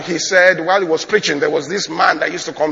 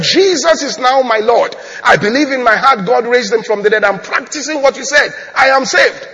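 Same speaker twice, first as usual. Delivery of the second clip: loud, raised-pitch declaration; emphatic insistence; 7 semitones higher, 6 dB louder than usual. Retold or imitated. Convert insists to the pastor he is saved, emphatic. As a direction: hammer out each claim, acting the convert.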